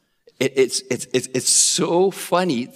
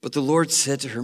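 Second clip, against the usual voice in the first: usual speaking voice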